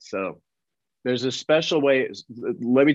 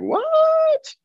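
very high, drawn-out what; mock-incredulous squeal of surprise; retold disbelief; 4 dB louder than usual. Actor told he booked the part, exaggerated disbelief. Retelling his shock at being booked.

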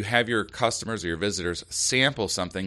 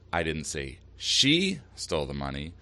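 single out "she" with a pitch jump; stress on SHE; 3 semitones lower than usual. Shown as a demonstration. Host giving an example of shifting meaning, stress on "she". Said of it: "emphasizing one word in that sentence".

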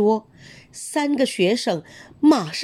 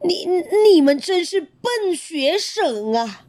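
angry teacher; 8 semitones higher than usual. A narrator's reading. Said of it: "熊老师发火了".

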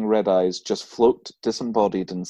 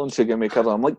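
usual speaking voice

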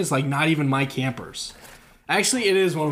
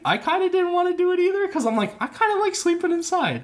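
high sheepish confession; 13 semitones higher than usual. Real, not acted. Camp counselor sheepishly admits he wanted to sleep inside.

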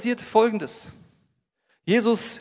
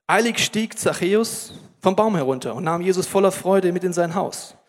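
loud, measured Bible reading; solemn scripture read to the congregation; 4 dB louder than usual. Acted. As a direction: read the passage like a proclamation. Bible passage read aloud.

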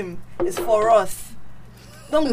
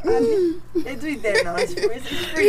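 laughing; 4 semitones higher, much slower than usual. Real, not acted. Teasing about same-sex marriage abroad.

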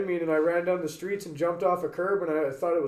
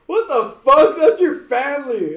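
higher and louder, a stunned what the fuck; shocked outburst; 8 semitones higher, 8 dB louder, slower than usual. Retold or imitated. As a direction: react in disbelief to the apology. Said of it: "I'm like".

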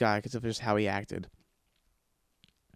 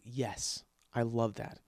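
softer, low-key director agreeing; hushed approval; 2 semitones lower, 7 dB quieter than usual. Retold or imitated. Director quietly approving the idea. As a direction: keep it quiet and encouraging. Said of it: "He's like".

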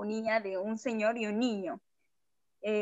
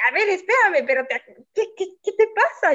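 alarmed protest; 8 semitones higher, 7 dB louder than usual. Retold or imitated. Startled by stranger grabbing her.

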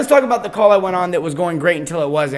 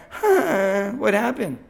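higher, softening, bewildered re-enactment; dazed gasp; 4 semitones higher, much slower than usual. Retold or imitated. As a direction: act out coming round with a gasp. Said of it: "I did one of these. I went".

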